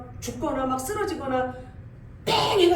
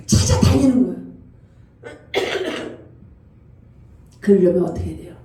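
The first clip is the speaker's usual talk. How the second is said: full-force preaching; 8 dB louder than usual. Acted. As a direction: preach it to the congregation with conviction. Preacher exhorts congregation.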